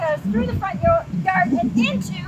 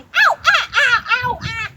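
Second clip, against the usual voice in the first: much higher, louder cries; yelling ow; 7 semitones higher, 9 dB louder, slower than usual. A narrator's reading. The dad under the bugs yells, high loud cries. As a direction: shout ow in pain.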